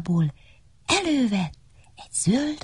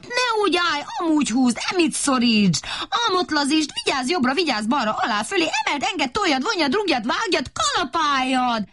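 high, rapid-fire shouted instructions; shouted orders; 8 semitones higher than usual. Acted. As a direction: bark a jumble of orders at the workers. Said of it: "kiabálni kezdtek". (magasan kiabált, hadaró utasítások)

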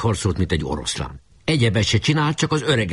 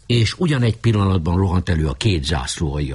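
usual speaking voice

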